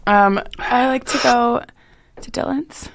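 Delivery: slow, labored through the burn; pushing words past the heat; mouth burning from spicy food. Real, not acted. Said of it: "my mouth's on fire".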